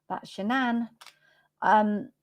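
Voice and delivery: usual speaking voice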